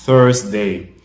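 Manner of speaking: teacher models Thursday; model pronunciation. Shown as a demonstration. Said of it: "en anglais se dit".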